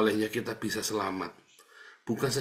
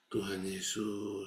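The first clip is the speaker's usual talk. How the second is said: slow, much softer murmured calling on Jesus; hushed prayer; 4 semitones lower, 11 dB quieter, much slower than usual. Retold or imitated. Sitting alone at four in the morning, praying: slow hushed murmur. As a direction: breathe the name out slowly, barely voiced, like an early morning prayer. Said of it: "saya cuma duduk".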